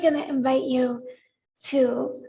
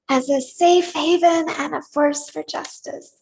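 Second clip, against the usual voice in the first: livelier, more emphatic reading in pieces; infused with personality. Shown as a demonstration. Leader models the vision for congregation to repeat, with personality. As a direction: read it as if you believe it. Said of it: "I invite you to infuse it with some personality".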